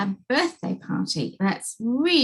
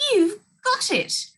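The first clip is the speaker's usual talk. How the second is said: giggling; 11 semitones higher, 3 dB louder than usual. Acted. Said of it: "she giggled".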